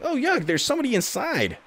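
high mock yuck; squeaky disgusted character; grossed-out voice. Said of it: "He's like".

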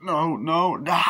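high, loud repeated cries; mock terrified pleading. Cornered man as werewolf nears, terrified pleading. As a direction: act out last-second panic. Acting victim about to be killed.

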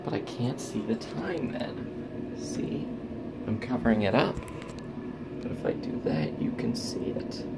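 soft whisper in the bathroom; hushed, keep it down; whispering while filming in the bathroom. Real, not acted. In bathroom, speaking softly.

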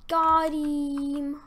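high, loud, stretched-out cheer; triumphant shout. Real, not acted. Gamer celebrating a hit, loud triumphant cry. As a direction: crow it loudly over the hit.

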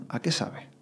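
hushed wondering whisper; whispered question. Retold or imitated. At a silent retreat meal, whispering to himself. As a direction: barely breathe the question aloud. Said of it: "yo decía".

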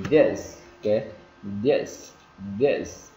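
"This" ending in a long clear hiss; emphatic "This" with sustained S; sounded final S. Shown as a demonstration. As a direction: push out the S at the end of "This". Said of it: "Cái âm S thì chúng ta phải bật ra".

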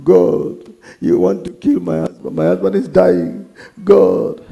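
mock tearful plea to God; imitated crying. Preacher imitating his wife praying for her dying husband, mock crying. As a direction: imitate a weeping wife's lament. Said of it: "and started crying".

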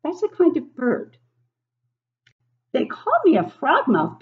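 a little bird happily summoning its dinner; frogmouth bird voice. Acted. Reads frogmouth bird's lines.

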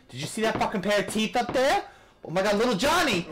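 high, loud excited outburst; excited yell. Real, not acted. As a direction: shriek in surprised delight. Sees teeth in game.

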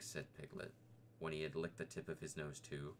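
plain reading voice

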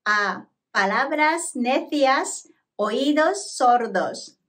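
very slow, word-by-word Spanish demonstration; slow, separated words with a pause after the first; slow pronunciation model. Shown as a demonstration. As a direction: draw it out carefully for students to repeat. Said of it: "まずはゆっくり発音します".

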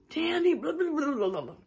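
high, garbled mock yelling of her name; mimicked angry mumbling. Retelling the ghostly voice of her ex, high mumbled mock yell. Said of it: "it was like a mumbling".

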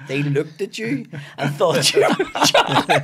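loud, slow, laughing punchline; laughing mock disbelief. Mother teasing her son over the traveller ruse, loud laughing disbelief. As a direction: drag out the jibe through laughter.

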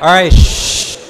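loud "all right" into a shush. Real, not acted. Speaker stopping the counting exercise, loud shushing. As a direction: hush the room forcefully. Hushing crowd after exercise.

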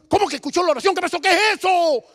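high, urgent volley of disbelieving questions; imitated flabbergasted father; acted astonishment. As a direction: demand an explanation, voice leaping up.

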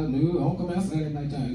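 ordinary delivery

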